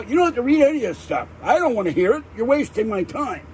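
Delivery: high-pitched, impatient cut-in; retold irritation, pitched well up; irritated retort. Customer cutting off a debt collector's script, irritated. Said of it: "That's why I start getting irritated".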